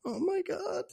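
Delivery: high, hushed aside breaking into laughter; Michael Keaton. Retold or imitated. Jack turning away from lingerie-clad neighbor, overwhelmed. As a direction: squeak it softly, imitating an overwhelmed man. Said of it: "Michael Keaton just turns away and goes".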